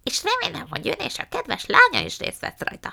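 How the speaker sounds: high put-on character voice; Missus Flecken. A narrator's reading. Missus Flecken invites mama to the hotel meeting, high put-on voice. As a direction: announce it in a high, affected character voice.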